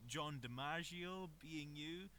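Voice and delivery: usual speaking voice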